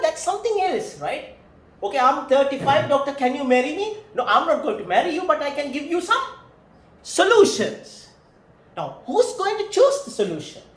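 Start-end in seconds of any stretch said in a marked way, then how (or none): 1.8-4.0 louder, higher pleading client; mock client. Lecturer voices the client asking the doctor to marry her, louder. 4.1-8.0 louder, firm refusal, slowing at the end; mock counselor. Lecturer plays a counselor turning the client down, louder and firm.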